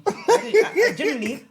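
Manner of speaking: high, loud laughing stammer. Real, not acted. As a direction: laugh through the words. Laughing at own situation.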